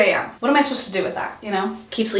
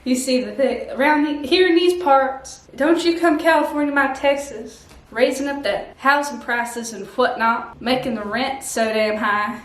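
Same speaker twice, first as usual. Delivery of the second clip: hammed-up Texas local's accent; terrible Texan accent. Texan warning off Californians over rent, mock Texan accent. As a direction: complain in a put-on Texan accent. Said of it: "this terrible accent".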